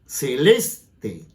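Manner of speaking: "Celeste" broken after a punched -les-; stress on penultimate syllable. Shown as a demonstration. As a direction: show the beat: ce-LES... te, for "Celeste". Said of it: "¿Ve el golpe?".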